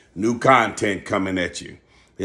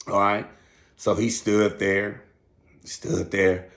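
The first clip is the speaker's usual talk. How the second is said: normal talking voice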